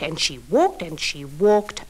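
and she walked, stretched slow and low; walked dragged long to show the long way; slow drawn-out walked. Acted. Storyteller repeats walking.

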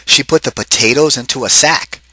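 ordinary delivery, nothing marked

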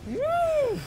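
high cheering whoop; excited whoop. Real, not acted. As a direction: whoop with joy.

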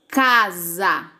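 "Casa" measured out as Ca, sa; "Casa" with each syllable given full weight. Shown as a demonstration. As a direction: sound out "Casa" in two even beats. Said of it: "Tem quantas sílabas?".

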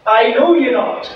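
high, slow, loud solemn judgement; the Lord's verdict. Acted. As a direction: pronounce it with final authority. The Lord shuts the door.

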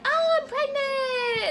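high, slow gushing announcement; mock pregnant lady. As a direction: play a smug lady sharing big news, slowly. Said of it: "a new lady like".

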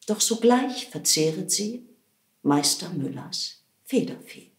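usual speaking voice

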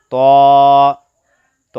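ত rounded and long held, like "to"; thick heavy t. Shown as a demonstration. Teacher models the letter, thick drawn-out ত. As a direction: stretch ত heavily from the tongue tip. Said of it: "মোটাভাবে আদায় হবে".

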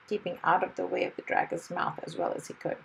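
plain reading voice

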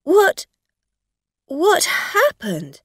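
high, loud, stammered alarm; halting shocked question, pitched right up; shocked cry. A narrator's reading. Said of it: "she cried".

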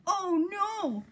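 high-pitched cry of alarm; cried out. A narrator's reading. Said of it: "cried Henry".

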